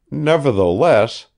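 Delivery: ordinary talk, nothing marked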